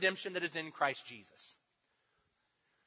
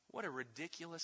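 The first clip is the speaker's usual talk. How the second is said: ordinary delivery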